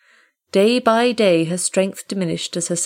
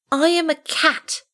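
very high kitten voice; baby animal; 11 semitones higher than usual. Acted. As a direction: squeak it like a young kitten. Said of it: "he said at last".

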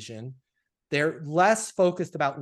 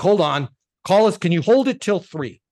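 louder, higher, hurried eagerness; eager clients; 3 semitones higher, 10 dB louder than usual. Retold or imitated. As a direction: play the bank begging him to hold the deal. Bank clients eager to sign.

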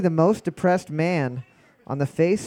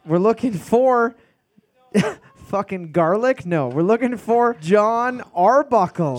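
slow, loud host reveal; 6 semitones higher, 3 dB louder, slower than usual. Acted. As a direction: draw out the answer.

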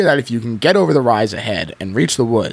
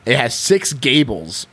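plain reading voice

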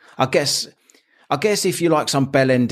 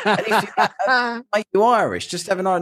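mocking; 12 semitones higher than usual. Mocking voice to the bloke.

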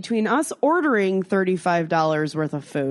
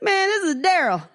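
high put-on voice of a laid-back stranger; Daryl's voice; 11 semitones higher, 4 dB louder than usual. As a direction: play the stranger casually introducing himself. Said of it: "He's like".

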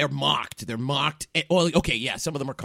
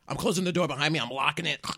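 ordinary talk, nothing marked

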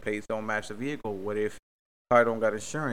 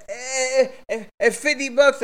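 high, loud mock whine; mocking other driver; 11 semitones higher, 8 dB louder, slower than usual. Mocking the driver who wants fifty bucks, whiny.

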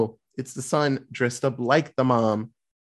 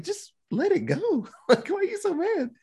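retold exasperated plea. Telling those angry at silent Angela, exasperated. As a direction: plead it in a much higher voice. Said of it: "I'm like".

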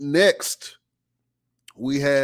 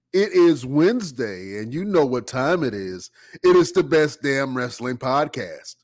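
normal talking voice

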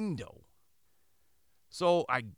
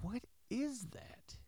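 high, soft and slow, wary; hushed alarm; 10 semitones higher, 10 dB quieter, slower than usual. Retold or imitated. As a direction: breathe it out, unnerved by the sound. Nighttime noise, wife beside him.

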